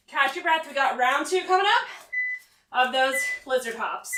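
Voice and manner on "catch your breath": ordinary talk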